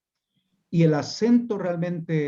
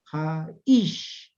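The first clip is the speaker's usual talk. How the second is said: "jaish" repeated as ja-ISH, drawn-out second syllable; final-syllable stress. Shown as a demonstration. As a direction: separate "jaish" in two and hit the final "ish". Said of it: "la pronunciación al final". («jaish» en dos sílabas, acento marcado al final: ja-ISH)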